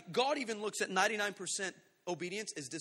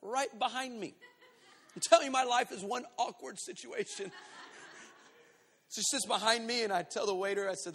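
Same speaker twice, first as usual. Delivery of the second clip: usual speaking voice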